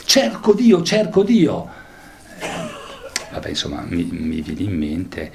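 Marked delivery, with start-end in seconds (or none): 0.1-1.7 high, loud proclaiming call; proclaiming cry. Acted. A lecturer calling out as the madman searching for God, ringing. Teacher voicing Diogenes' cry.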